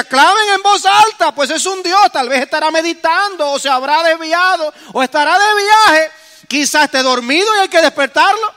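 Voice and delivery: high, loud sarcastic jeering; mocking taunt. Preacher voicing Elijah mocking Baal's prophets. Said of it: "Elías comenzó a burlarse de ellos".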